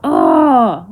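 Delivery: loud exasperated groan; angry ugh. Real, not acted. Vlogger overwhelmed by anger, loud groan. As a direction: groan out the frustration. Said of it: "I was yelling so much".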